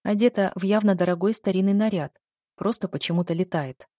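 usual speaking voice

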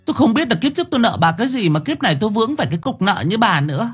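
high, fast angry tirade at the ghost; shrill scolding. A narrator's reading. Angry woman scolding the ghost over her lost hens. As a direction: yell at the ghost like an enraged village woman. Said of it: "nghe tiếng cô Thơm choa choả".

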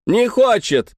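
high, gloating shout. A narrator's reading. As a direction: crow it out triumphantly. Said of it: "закричала билетёрша".

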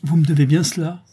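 stern, forceful reproach; hard voice. A narrator's reading. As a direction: press him hard, without pleading. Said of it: "insista-t-elle d'une voix dure".

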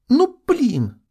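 high indignant whine; offended tone. Acted. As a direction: pout and protest sharply. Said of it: "резко вернувшегося обиженного тона".

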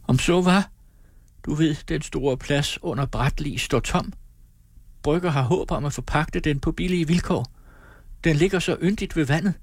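put-on high, hopeful girl's voice; dreaming aloud of a home by the water; eager young woman. Amalie forsvarer sin kæreste. (en ung piges lyse, håbefulde stemme)

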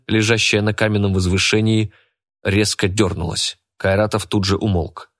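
ordinary delivery, nothing marked

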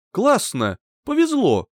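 joyful exclamation. A narrator's reading. New acquaintance delighted to meet someone known, mildly joyful. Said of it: "радостно воскликнул мой собеседник".

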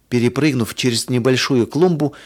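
plain reading voice

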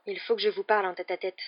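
ordinary delivery, nothing marked

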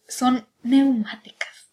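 slow whispered word. Acted. Telling a friend about the novel, slow secret whisper. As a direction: breathe the word out slowly, secretive.

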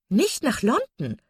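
high, drawn-out disbelief; laughing exclamation. Acted. Mrs Palmer hearing they won't come to London, playfully incredulous. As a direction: exclaim in slow, playful astonishment. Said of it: "rief Misses Palmer lachend".

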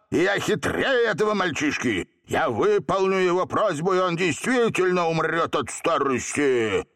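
high-pitched, domineering genie tirade; furious yell. A narrator's reading. Genie threatening to destroy the boys, yelling. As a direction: command silence, then proclaim your power. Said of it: "дрожа от злобы, заорал".

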